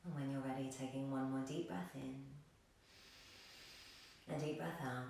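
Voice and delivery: soft, low meditation voice with long pauses; hushed, calm yoga guide; guided meditation. Real, not acted. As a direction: lull them into stillness.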